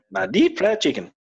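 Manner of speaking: hammed-up Southern US accent, pitched high; a bad Southern US drawl, played for laughs. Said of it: "That's my, uh, southern drawl".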